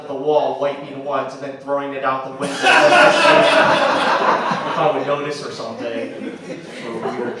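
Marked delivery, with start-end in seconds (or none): 2.6-4.7 loud high laugh, falling away; real laugh at the punchline. Real, not acted. Laughs at own joke.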